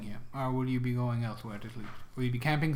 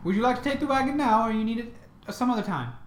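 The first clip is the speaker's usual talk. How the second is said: man doing a woman's voice; 7 semitones higher, faster than usual. Acted. GM voicing the cart lady.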